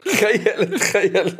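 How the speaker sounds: laughing through the word. Real, not acted. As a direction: chuckle while repeating it. Laughing at friend's joke.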